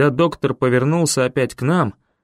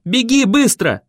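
much higher, louder shout; urgent shout; 9 semitones higher, 4 dB louder than usual. A narrator's reading. Boy yelling at Ben to escape, urgent shout. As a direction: yell at him to run. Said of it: "бросил я Бену".

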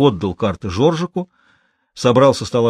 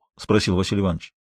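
plain reading voice

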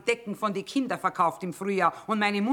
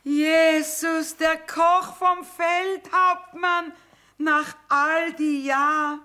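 much higher and louder, very slow exclamation; higher, louder, drawn-out astonished greeting; delighted surprise; 10 semitones higher, 5 dB louder, much slower than usual. Acted. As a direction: stretch out the joyful recognition. Sees old friend after years.

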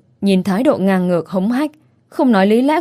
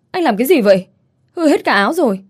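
raised, higher angry cry; angry outburst; 5 semitones higher, 3 dB louder than usual. Acted. Shop girl scolding the rude customer, angry and raised. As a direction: snap at him over the ruined shirt. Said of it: "Tôi điên quá".

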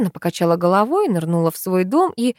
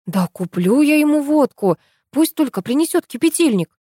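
higher-pitched impatient exclamation; exclaiming; 7 semitones higher than usual. A narrator's reading. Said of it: "воскликнула я". (нетерпеливо воскликнуть)

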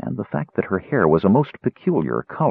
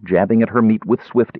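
usual speaking voice

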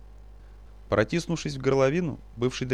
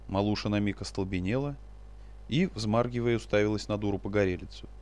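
ordinary delivery, nothing marked

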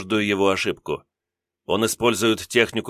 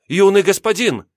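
alarmed cry, much higher, louder; frantic cry; 11 semitones higher, 5 dB louder than usual. Acted. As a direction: cry out in panic for him. Servant cries out again, more frantic.

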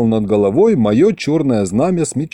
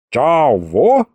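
unsure rustic reply, rising at the end; hesitant; 2 semitones higher than usual. A narrator's reading. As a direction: draw out a wary question from the wall. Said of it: "неуверенно отозвались со стены". (неуверенно, с вопросом)

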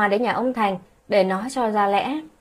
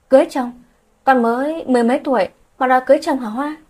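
a mother's shocked, scolding yell, higher and faster; angry shout; 4 semitones higher, 5 dB louder, faster than usual. A narrator's reading. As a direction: snap at her daughter in disbelief. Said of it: "Cô tức giận quát lên". (mẹ tức giận quát con gái)

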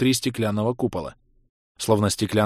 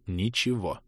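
plain reading voice

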